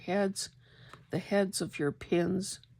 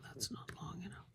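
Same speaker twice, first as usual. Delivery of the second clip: whispering; 15 dB quieter, faster than usual. Real, not acted. Muttering to self while sewing.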